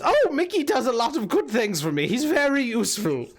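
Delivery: fond hag, lilting voice; hag character. Acted. Hag vouches for Mickey to the party, fond hag voice. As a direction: praise your creature in the hag's voice.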